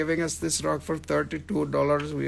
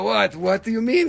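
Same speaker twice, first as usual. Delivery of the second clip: ordinary talk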